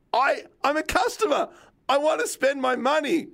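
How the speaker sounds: high-pitched, pleading retold outburst; fed-up protest climbing to a squeal; exasperated customer. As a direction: plead your case in comic exasperation. Retold fed-up outburst at bike stores.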